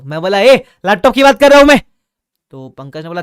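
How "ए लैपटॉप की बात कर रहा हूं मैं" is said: loud, high angry shout at the shopkeeper. Retold or imitated. Shouting at Pankaj about the laptop. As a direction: yell it across the shop.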